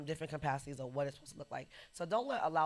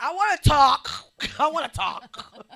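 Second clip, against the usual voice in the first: put-on high, loud drunken insisting; loud drunk demand; 11 semitones higher, 18 dB louder than usual. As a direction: demand the mic, tipsy. Drunk wanting the podium.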